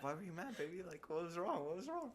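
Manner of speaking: high, sugary mock concern; fake sweet concern. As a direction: sweet-talk the girlfriend to cover up. Sweet-talking girlfriend.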